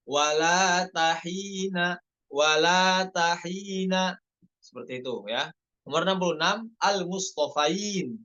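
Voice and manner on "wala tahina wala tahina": slow chant of "wala tahina"; "wala" and "tahina" read smooth and slow; correct reading. Shown as a demonstration. Teacher giving the correct reading of "wala tahina". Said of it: "baca yang benar".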